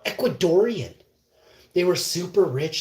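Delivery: ordinary talk, nothing marked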